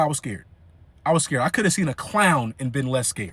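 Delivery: ordinary delivery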